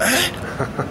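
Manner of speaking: a high, startled "Eh?"; sudden confusion as the legs give way; baffled. Retold or imitated. Boxer recalling his legs going, a baffled high eh. Legs gone after getting up.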